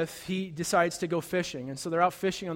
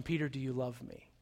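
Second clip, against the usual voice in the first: tender question; 5 semitones lower, 9 dB quieter than usual. Acted. Jesus gently questions ashamed Peter.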